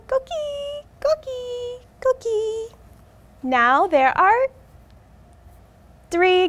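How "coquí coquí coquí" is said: high soft frog chirps; coqui frog call. Acted. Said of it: "they sing softly".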